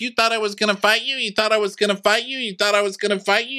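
high, mocking singsong taunt said over and over; taunting mimic. One housewife taunting another about a fight, high mocking singsong. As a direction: needle her with a high repeated taunt.

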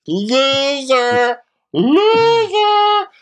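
high, loud schoolyard taunt "Loser!"; taunting shout. Retold or imitated. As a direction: jeer it at him at full voice. Rhaenys taunting Daemon.

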